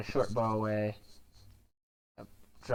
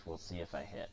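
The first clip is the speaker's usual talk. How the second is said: usual speaking voice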